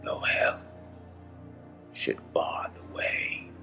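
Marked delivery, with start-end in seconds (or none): none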